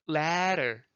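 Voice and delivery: leather drawn out as "leh-rer"; flapped middle consonant. Shown as a demonstration. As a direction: loosen the middle of leather to an R-like tap. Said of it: "호흡 안 나가죠".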